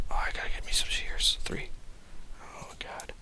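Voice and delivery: breathy whispered aside. Real, not acted. Player whispering to himself about shears, hushed whisper. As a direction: whisper it to yourself. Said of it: "whispered aside".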